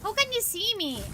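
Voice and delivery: high, alarmed gamer panic; spotted and suddenly scared; panicking gamer. Real, not acted. Enemy spots player in game.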